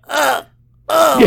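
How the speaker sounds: short high-pitched little "uh" noises; imitated Dalek noises. Co-host showing what the Dalek sounded like, high imitation. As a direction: imitate small Dalek huffs, high and spaced out. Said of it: "it was just like".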